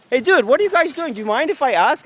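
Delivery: high, loud, eager friendliness; friendly ask. Acted. Vlogger questions the workers, high and overly friendly. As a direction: play the nice curious guy.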